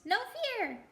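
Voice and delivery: a high, perky squawk of a reply; loud jackdaw cry. Acted. Perky jackdaw shouting into the silence, loud. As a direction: blurt it out high and cheeky. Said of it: "added in a loud voice".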